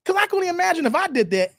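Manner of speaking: usual speaking voice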